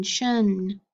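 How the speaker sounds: "shun" said alone as one clear syllable; isolated sound. Shown as a demonstration. Phonics teacher modelling "shun" for children, slow and clear. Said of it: "Final syllable".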